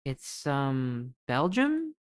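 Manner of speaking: normal talking voice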